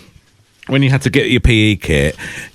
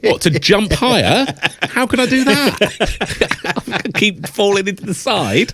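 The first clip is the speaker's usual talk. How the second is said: laughing disbelief; 9 semitones higher, slower than usual. Retold or imitated. Retorting to teacher, laughing.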